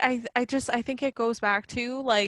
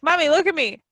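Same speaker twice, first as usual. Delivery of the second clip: high, louder imitation of a little boy; child calling Mommy; 7 semitones higher, 6 dB louder, faster than usual. Mom imitates her five-year-old son, high attention-seeking child voice. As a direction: call out like a kid wanting attention.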